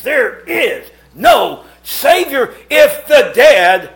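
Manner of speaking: hammed-up scolding of sinners; mock hellfire preacher. Imitating Brother David berating sinners, building to a shout. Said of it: "here's Brother David".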